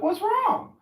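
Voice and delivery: very high, loud worried question; high concerned voice; concerned passer-by. Retold or imitated. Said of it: "I'm like".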